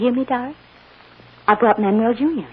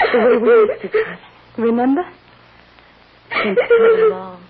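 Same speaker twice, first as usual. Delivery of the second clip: near tears; 8 semitones higher, 6 dB louder than usual. Acted. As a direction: hold back the tears as you remember. Mother fighting tears.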